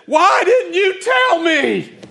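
high, slow, loud indignant shout; mock outraged shout. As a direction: yell it out in comic dismay. Shouting at wife in dismay.

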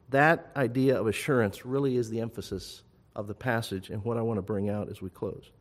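ordinary talk

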